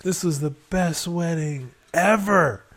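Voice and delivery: loud, slow emphatic rave; gleeful enthusiasm. Retold or imitated. Husband to wife after chaotic wedding, gleeful rave.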